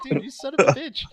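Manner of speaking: high, loud blurted curse; exasperated curse. Retold or imitated. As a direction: burst out in fond disbelief. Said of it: "I'm like".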